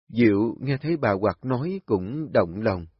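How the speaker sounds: plain reading voice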